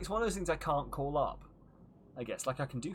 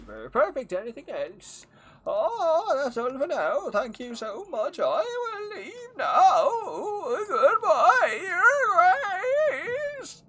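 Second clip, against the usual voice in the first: very high, loud, wailing merchant farewell; tearful merchant goodbye; 10 dB louder, much slower than usual. Acted. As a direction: sob out the goodbye like a heartbroken peddler. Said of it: "he's got a weird accent".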